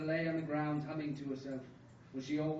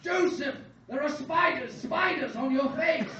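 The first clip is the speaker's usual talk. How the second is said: loud, high-pitched panicked shout; gasped alarm; 10 semitones higher, 11 dB louder than usual. A narrator's reading. Father sees son's face, panicked yelling. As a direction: scream the warning in hammed-up horror. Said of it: "His father gasped".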